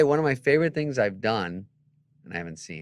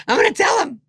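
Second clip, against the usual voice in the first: much higher and louder, cackling; laughing gleeful threat; 13 dB louder than usual. Retold or imitated. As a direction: laugh it out, delighted to snitch. Manager laughing, quoted.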